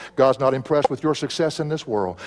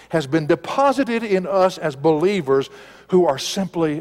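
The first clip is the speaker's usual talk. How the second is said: usual speaking voice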